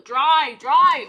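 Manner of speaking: loud, pleading call; loud urging. Real, not acted. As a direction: shout at the paint to dry. Urging paint to dry.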